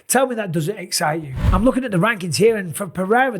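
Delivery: usual speaking voice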